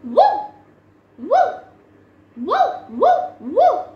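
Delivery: high, loud yappy woofs; puppy woof. Pretend. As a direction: bark like a little puppy. Dog barks in a kids' song.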